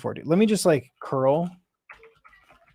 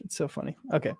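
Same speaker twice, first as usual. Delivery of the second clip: ordinary talk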